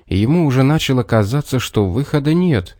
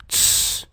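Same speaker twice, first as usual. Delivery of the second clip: soft, long "tsss"; hissed shush; 8 dB quieter than usual. A narrator's reading. Fox silences the bear, hissing a shush. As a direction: hush him with a sharp hiss. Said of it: "прошипела она".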